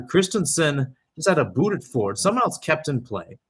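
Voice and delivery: ordinary talk, nothing marked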